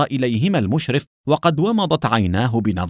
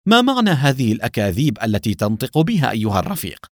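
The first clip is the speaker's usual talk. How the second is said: usual speaking voice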